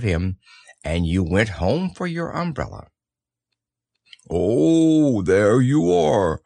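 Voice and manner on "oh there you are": calling down. Acted. Pooh calling down from his balloon on his friend's return, loud call. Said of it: "called down Winnie the Pooh".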